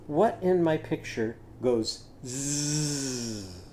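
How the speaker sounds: very slow buzzing zzz; a teacher making the zipper sound. Shown as a demonstration. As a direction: buzz the z like a zipper. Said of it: "teacher buzzing z sound".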